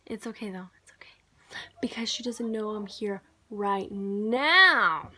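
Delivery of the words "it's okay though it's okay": hushed secret whisper; whispering so she won't hear. Real, not acted. Hiding from her sister, whispering to the camera. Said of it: "hide my suitcases".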